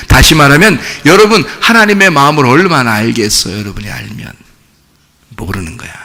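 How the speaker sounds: ordinary talk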